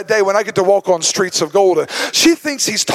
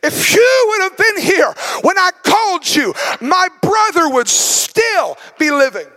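raised, pleading shout, higher; Martha's reproach; 8 semitones higher than usual. Acted. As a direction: press the accusation, each line rising. Martha reproaches Jesus, grieving.

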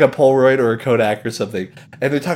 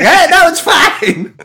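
much higher, louder, slow brush-off; breezy mock dismissal; 6 dB louder, slower than usual. Imitating the host ignoring the warning, hammed-up confidence. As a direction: wave it off, too sure. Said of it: "he's like".

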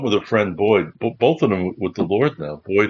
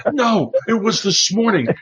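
insistent John; 12 semitones higher than usual. Retold or imitated.

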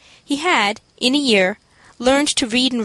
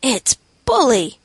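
slow, admiring street slang; a boy admiring the room, slowly; slower than usual. A narrator's reading. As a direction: savour it with admiration. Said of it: "in admiring accents".

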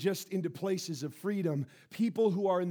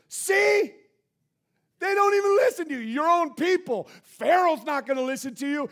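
high, loud, fast angry protest; angry complaint; 9 semitones higher, 10 dB louder than usual. Acted. Moses complaining to God, loud and angry. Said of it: "he becomes angry at God".

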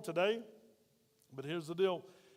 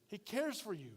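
tender, soft; 6 dB quieter than usual. Acted. Pastor repeats promise softly.

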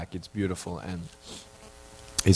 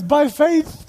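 much higher, deadpan reply; pastor's answer; 3 dB louder than usual. Retold or imitated. Pastor answering the guests, high deadpan punchline. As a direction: deliver the punchline like a knowing pastor. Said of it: "the pastor goes".

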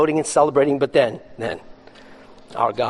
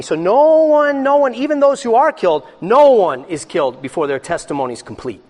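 emphatic preaching; 6 semitones higher, 7 dB louder than usual. Real, not acted. Preacher building to emphatic point.

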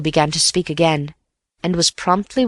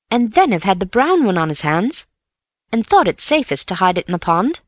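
put-on lightly raised, keen young man; a hint of a boyish friend pressing his point; Bill's eager voice; 6 semitones higher than usual. A narrator's reading. Bill argues his theory.

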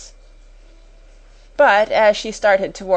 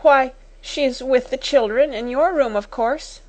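higher, mildly flustered answer; bewildered stammer; 6 semitones higher than usual. A narrator's reading. Timid sister answering the angry schoolmistress, bewildered. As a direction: answer as if taken aback. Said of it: "she stammered".

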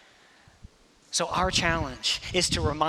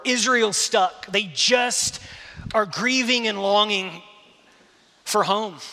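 loud, raised preacher's voice driving each point home; preaching at full force; 5 semitones higher, 6 dB louder than usual. Acted. Preacher urging the congregation to receive grace, loud and driving. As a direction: preach it out, pushing hard at the congregation.

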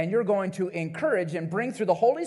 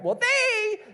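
whiny blame; 3 dB louder than usual. Retold or imitated. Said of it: "that's what we always blame".

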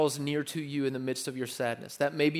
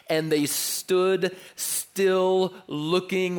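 slow loud emphatic reading; dramatic reading; 4 semitones higher, 5 dB louder, much slower than usual. Real, not acted. Preacher reading verse seventeen, slow and loud. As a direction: draw out the suspense. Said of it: "Look at the tail end".

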